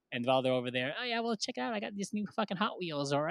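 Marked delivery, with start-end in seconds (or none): 1.0-3.1 softer, higher, as if overheard across the room; bragging classmates. Retold or imitated. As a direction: imitate kids showing off in the background. Classmates showing off their gifts.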